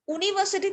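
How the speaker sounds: यूनिवर्सिटी begun with "oo", no y sound; wrong pronunciation. Shown as a demonstration. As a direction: say यूनिवर्सिटी as "ooniversity". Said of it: "तो नहीं बोलते ना अब हम".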